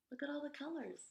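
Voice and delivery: usual speaking voice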